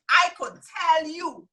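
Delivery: slow, emphatic Virgin Islands accent. Real, not acted. Teacher promising her group they'll earn, insistent Virgin Islands accent. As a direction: stretch it out in a VI accent. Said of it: "that means I can tell you".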